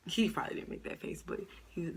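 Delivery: usual speaking voice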